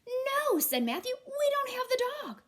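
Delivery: put-on high loud kid voice; child shouting. Matthew telling dad what's missing, high and loud.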